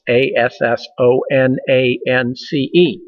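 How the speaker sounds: A S S O N A N C E, each letter named separately; spelled out letter by letter. Shown as a demonstration. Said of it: "and that's called assonance".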